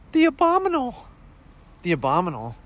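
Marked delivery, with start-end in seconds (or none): 0.1-1.1 very high cartoon clerk voice; high-pitched questioning clerk; shop clerk character. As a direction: offer it in a squeaky, pitched-up voice. Clerk voice against customer's echo.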